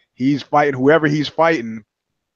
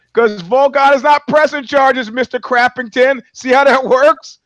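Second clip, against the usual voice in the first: higher, louder sarcastic scolding; mocking lecture; 9 semitones higher, 6 dB louder than usual. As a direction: lecture Mister Crappington with mock glee. Mocking lecture to imagined accuser.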